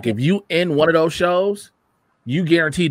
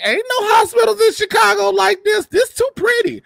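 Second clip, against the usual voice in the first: high falsetto scoff; mock disbelief; 3 dB louder than usual. Chicago native scoffing at the TV hospital, in high mock disbelief. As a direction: protest it in a squeaky, exaggerated falsetto.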